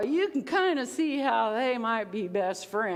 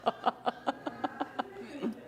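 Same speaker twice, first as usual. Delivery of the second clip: breathy disbelieving laugh; 3 semitones higher, 7 dB quieter than usual. Shown as a demonstration. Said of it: "the disbelief".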